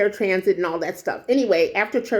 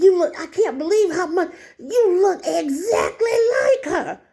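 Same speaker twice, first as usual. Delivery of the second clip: put-on high, wailing old lady voice; imitated elderly woman; 7 semitones higher than usual. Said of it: "this is how she talked".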